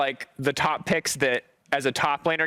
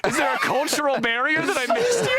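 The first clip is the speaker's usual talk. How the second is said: high, laughing question; 10 semitones higher than usual. Real, not acted. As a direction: ask while cracking up.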